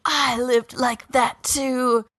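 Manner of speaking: slow, high, lightly mocking counselor voice; a hint of a gentle counselor, slow; counselor mode. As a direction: soothe him like a hammed-up therapist. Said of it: "counselor mode".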